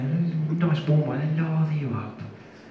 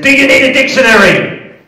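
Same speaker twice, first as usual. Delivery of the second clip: higher, louder frustrated jab; frustrated sarcasm; 11 semitones higher, 11 dB louder than usual. Retold or imitated. Frustrated prisoner mocking the interrogator, loud sarcasm. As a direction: snap it back in frustration. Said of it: "I was getting quite frustrated".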